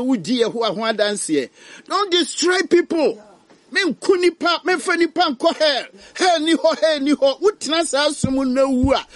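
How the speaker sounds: high, loud urgent preaching; fiery pulpit warning; impassioned preaching. Real, not acted. Preaches at full force.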